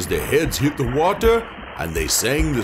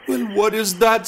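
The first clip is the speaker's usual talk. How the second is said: high spirit voice; 9 semitones higher than usual. Acted. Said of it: "said the river spirit".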